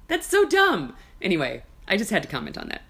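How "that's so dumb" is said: exasperated exclaim. Real, not acted. Exclaiming at a saying.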